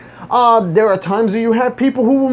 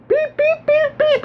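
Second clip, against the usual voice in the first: string of high, squeaky beeps; imitated bird beeps; slower than usual. Mocking the question no teenager would ask, high imitated beeps. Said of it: "no teenager's gonna be like".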